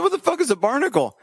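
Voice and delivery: high, fast disbelieving outburst; shocked. Retold or imitated. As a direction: blurt it out in disbelief. Retelling his shocked reply to doctor.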